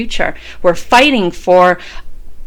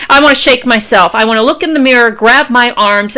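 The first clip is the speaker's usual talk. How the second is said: usual speaking voice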